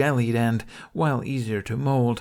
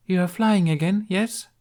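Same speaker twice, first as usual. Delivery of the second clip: higher, polite questioning empress; cordial empress; 9 semitones higher, 4 dB louder than usual. Acted. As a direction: ask it with courtly politeness. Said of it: "she asked this cordially".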